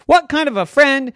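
high, loud and quick complaining; a hoop ranting at a careless friend. Acted. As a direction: list every grievance to her friend. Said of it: "She said".

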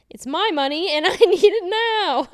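high, loud hammed-up outburst; playful over-the-top shout; mock demanding yell. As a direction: holler it like a silly demand, nearly laughing.

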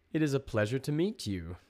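stern, respectful high-pitched address; stern yet respectful. A narrator's reading. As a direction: greet them loudly with stern courtesy. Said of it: "His voice was stern and yet respectful".

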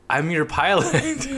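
slow, high mock-heroic; hammed-up pilot line. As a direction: announce it like a hammy hero. Retelling Joe's line.

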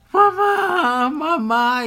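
retelling the scam caller's desperate cry; imitated caller crying mamá. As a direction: wail it like a scared kid on the phone. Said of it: "y empezó".

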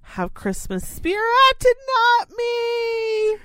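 high, slow, tearful whine; weepy lament. Retold or imitated. Envying neighbours' lawn decorations, a high tearful whine. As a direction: mourn that everyone else has the spirit. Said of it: "I'm like".

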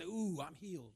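softly groaned declaration of faith; wincing through pain. Acted. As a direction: act a pang, then affirm it quietly. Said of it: "every time the pain comes".

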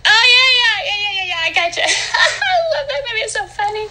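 high, squealing laughter; shrieking laugh. Acted.

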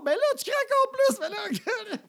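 extremely high, loud mock shriek; hysterical teen screech. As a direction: escalate into a hammed-up teenage wail. Imitated daughter accuses him, escalating.

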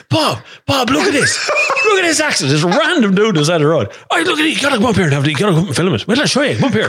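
hyped stranger calling Bob over; excited shout. Retold or imitated. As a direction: wave him over like you can't believe it.

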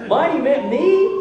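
mock-astonished echo; acted disbelief. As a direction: jump up in pitch on the incredulous question. Acting listeners' disbelief.

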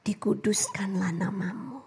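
reverent whisper of the prayer line; hushed prayer whisper. Acted. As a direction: breathe it out in awe. Quoting Lord's Prayer.

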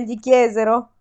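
usual speaking voice

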